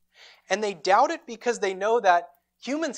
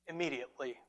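soft Immediately; 5 semitones lower, 11 dB quieter, faster than usual. Shown as a demonstration. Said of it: "Immediately. Immediately".

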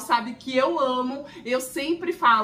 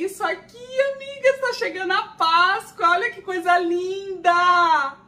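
louder, higher gushing; thrilled, loud and high; gushing excitement; 6 semitones higher, 7 dB louder than usual. Real, not acted. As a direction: rave over the Easter gift. Gushing over Easter gift.

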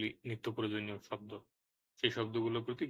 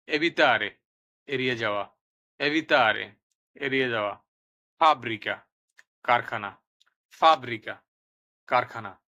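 loud, emphatic word-by-word dictation with falling endings; vocabulary drill; 8 dB louder, slower than usual. Shown as a demonstration. Teacher has learners repeat Italian words with Bengali meanings, emphatic drill.

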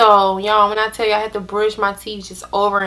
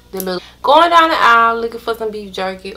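fast, quicker retake; fast repeat; 2 semitones higher, faster than usual. Retold or imitated. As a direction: rush through it again. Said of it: "repeated faster".

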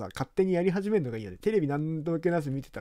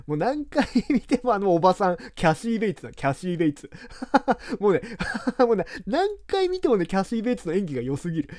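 laughing gushing; 6 semitones higher, 3 dB louder than usual. Real, not acted. Gushing about Kathy Bates in Misery.

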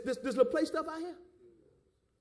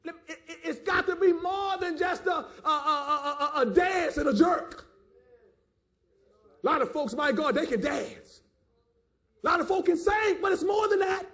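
higher, driving pulpit exhortation; impassioned sermon delivery; fervent preaching; 6 semitones higher, 5 dB louder than usual. Real, not acted. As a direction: build from a stammer into a preacher's cry. Preaches at full force.

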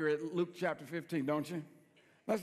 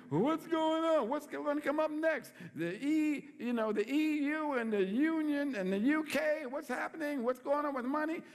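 worried people; 6 semitones higher than usual. Acted. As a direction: ham up the panic of folks watching the news. Voicing worried people about news.

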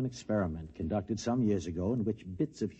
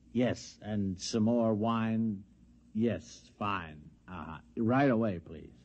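normal talking voice

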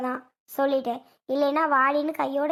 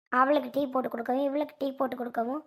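ordinary talk, nothing marked

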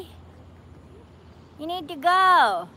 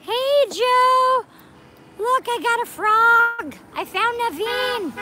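higher and louder, calling across the lot with delight; excited call out; 9 semitones higher, 5 dB louder, slower than usual. Real, not acted. Calling out to Joe about the frog, higher and louder with delight. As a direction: show off the frog, thrilled.

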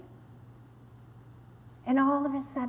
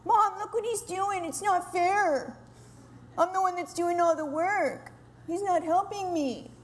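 put-on higher, complaining child's whine; whining kid; 6 semitones higher than usual. A kid tattling on a sibling to mom, higher childish whine. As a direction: whine to mom that it's not fair. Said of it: "We sound just like that sometimes".

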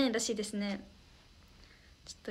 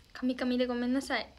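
ordinary talk

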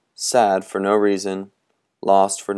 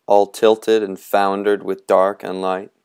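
plain reading voice

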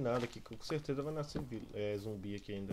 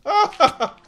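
loud startled scream; 21 dB louder than usual. Real, not acted. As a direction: yell out at dying. Dies to zombie.

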